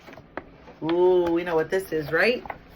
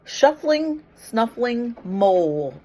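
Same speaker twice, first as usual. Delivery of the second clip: storybook reading; 4 semitones higher, 5 dB louder, slower than usual. Acted. Teacher reading picture book to kids.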